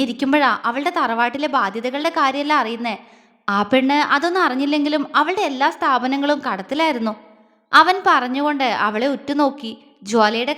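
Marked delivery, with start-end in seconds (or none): none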